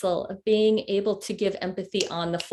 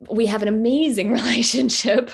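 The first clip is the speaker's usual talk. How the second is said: a real laugh breaking into amazing, a bit louder; happy laugh mid-phrase; 3 semitones higher, 6 dB louder than usual. Real, not acted. Mom boasting about her bond with her son, laughing. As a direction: let the joy spill out as a laugh.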